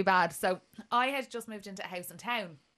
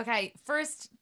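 ordinary delivery

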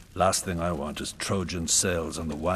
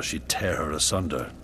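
ordinary talk

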